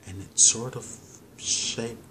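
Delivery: slow, measured counting with long pauses; calm, paced meditation guide; guided breathing meditation. Real, not acted. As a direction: lead them through each breath unhurriedly, drawing out the instructions.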